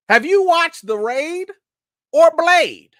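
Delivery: high-pitched playful outrage; hammed-up accusing whine; mock indignation. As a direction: call him out in a high, incredulous voice. Mock scolding of a viewer.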